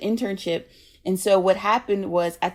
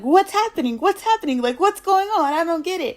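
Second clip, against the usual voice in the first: much higher, louder, flustered repeated questions; bewildered alarm; 10 semitones higher, 4 dB louder than usual. Retold or imitated. Speaker retelling confusion at Larry crying, high bewildered voice. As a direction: play the baffled friend caught off guard.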